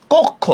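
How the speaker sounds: কক্ষ said as "kok-kho", k held before kh; doubled consonant. Shown as a demonstration. Teacher showing the mid-word sound to students, doubled-consonant কক্ষ. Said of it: "কক্ষ উচ্চারণটা".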